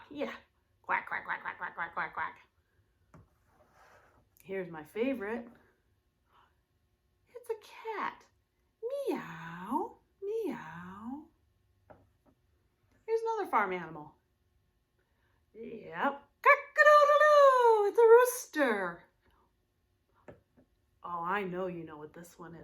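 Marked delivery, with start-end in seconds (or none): 0.8-2.4 quacking duck. Shown as a demonstration. Teacher quacking like a duck for kids. 9.1-11.3 meowing cat. Shown as a demonstration. Teacher meowing like a cat for kids. 16.4-17.9 very high loud crowing call; rooster crow. Shown as a demonstration. Teacher crowing like a rooster for kids.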